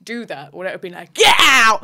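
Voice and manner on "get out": explosive, high Cockney bellow; hammed-up East End yell; Phil Mitchell shout. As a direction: roar "Get out!" like a London soap hard man. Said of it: "I had to shout".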